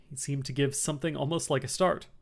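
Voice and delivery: ordinary delivery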